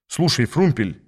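usual speaking voice

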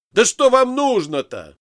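high, loud, exasperated outburst; loud cry of exasperation; breaking into a shout. A narrator's reading. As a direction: snap and yell it at your captors. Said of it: "сорвался я на крик".

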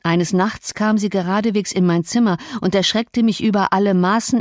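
plain reading voice

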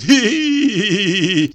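high, slow squealing titter like a foal; high crazed whinnying laugh. A narrator's reading. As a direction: squeal madly while beating her. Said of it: "как жеребёнок, повизгивает".

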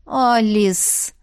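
«Алис» stretched out meaningfully, falling; drawn-out special tone. A narrator's reading. To a friend offering help, drawn-out special tone. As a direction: draw the name out in gentle reproach. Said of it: "протянула особым тоном".

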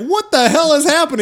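high, loud bewildered cry; baffled outburst. Retold or imitated. Readers of the comic yelling what is going on, baffled. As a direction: throw up your hands in total confusion. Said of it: "we're like".

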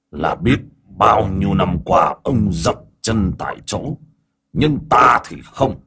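slow, drawn-out villain voice; deliberate boast, lifting at the end; gloating demon elder. Acted. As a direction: lord it over a stagnant rival.

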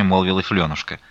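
plain reading voice

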